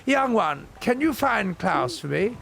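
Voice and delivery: put-on high, slow old woman pleading; old lady voice. Old lady asks the diver to find Klaus, high and slow. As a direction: ask sweetly like a frail old lady.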